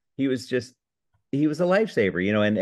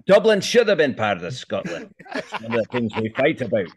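put-on Scottish accent. Scottish character jokes to the hosts about Dublin. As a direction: grumble it as a proud Scot. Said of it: "a Scottish [name]".

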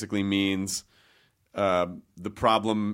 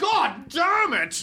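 high, drawn-out cry in a bad British accent; upset British director; much slower than usual. Upset director cursing on set in a mock British accent. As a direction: explode as a hammy British director. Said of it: "he starts to sound British".

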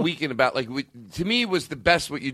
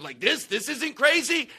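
high, slow mocking incredulity; sarcastic disbelief; 7 semitones higher, slower than usual. Mocking the objector who won't accept it, sarcastic. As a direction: mimic the scoffer, drawn out and high. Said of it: "Stop saying it in a sarcastic way".

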